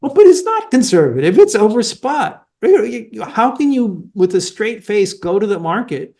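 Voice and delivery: high, emphatic protest; indignant rebuttal. Real, not acted. Indignant rebuttal to the imagined companies.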